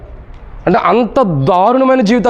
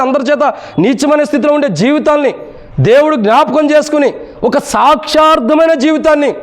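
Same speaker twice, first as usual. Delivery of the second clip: high, sustained sermon delivery; impassioned preaching; 9 semitones higher than usual. Real, not acted. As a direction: preach it in a high, driving chant-like voice. Preaches at heightened pitch. (ఉద్వేగంతో ఎత్తైన స్వరంలో ప్రసంగం)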